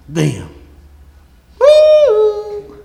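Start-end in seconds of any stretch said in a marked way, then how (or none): 1.6-2.8 a sudden high falsetto whoop; preacher's whoop. Real, not acted. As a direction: let out a joyful high holler. Preacher whoops mid-sermon.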